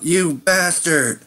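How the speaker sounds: loud, high, slow shout of betrayal; furious yell. Acted. Dying man yells at his poisoner, furious shout. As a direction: yell it out with all your breath. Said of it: "he yells out".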